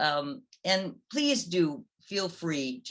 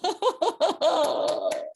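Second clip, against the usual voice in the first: high bright burst of laughter; laughing after the song; real laugh; 13 semitones higher, 4 dB louder than usual. Real, not acted. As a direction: laugh freely at the end. End of the Medusa song.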